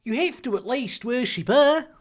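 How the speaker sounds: high, put-on indignant voice; mock-angry character voice; angry insistence. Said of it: "Ronald posited angrily".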